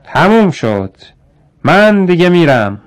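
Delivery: loud, high-pitched announcement; shouting. A narrator's reading. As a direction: call it out loudly, pitch lifted. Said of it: "پیتر فریاد زد".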